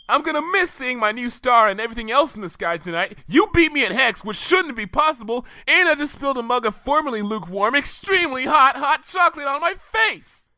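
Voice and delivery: high-pitched petulant tirade; snit rant. A narrator's reading. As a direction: complain like a sulking know-it-all. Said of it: "entering full snit mode".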